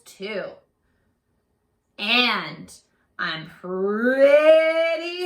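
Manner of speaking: hammed-up scary wolf; hungry big wolf. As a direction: rumble it like a greedy beast. Big wolf voiced in story.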